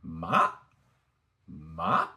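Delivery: MA starting low and rising sharply; rising MA, like climbing a hill; second tone. Shown as a demonstration. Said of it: "Second tone".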